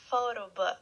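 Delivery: photo book, stress on PHO, falling end; model word photo book. Shown as a demonstration. Teacher models photo book for the class to repeat. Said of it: "Let's repeat".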